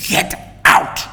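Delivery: hissed, breathy and unvoiced. A narrator's reading. Attacker dragging her by the hair, hissed. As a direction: spit it through the teeth. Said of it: "he hissed".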